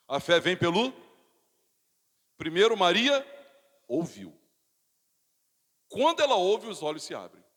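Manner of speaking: loud, high-pitched sermon delivery; full-force preaching. Acted. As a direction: preach it loudly, lifting key phrases high.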